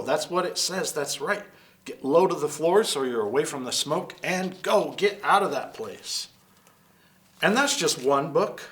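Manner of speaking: plain reading voice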